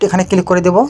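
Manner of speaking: normal talking voice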